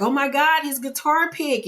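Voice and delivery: put-on high-pitched delighted little girl; high, gushing retold joy; excited, happy child. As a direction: imitate a kid thrilled by a gift. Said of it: "she's just so excited and happy".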